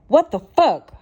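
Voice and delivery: sudden high furious shout; angry yell. A narrator's reading. Sean storming at her from the doorway, yelling. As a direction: bark it out in a rage. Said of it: "Sean yelled".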